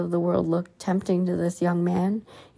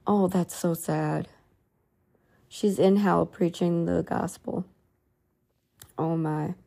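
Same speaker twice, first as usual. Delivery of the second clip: real sadness; 4 dB quieter than usual. Real, not acted. Reader reacts to a soul in hell, saddened. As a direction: let the sadness quietly settle in.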